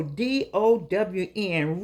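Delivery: "D O W N" with each letter said slowly and separately; spelled out slowly. Real, not acted. Spelling out the word.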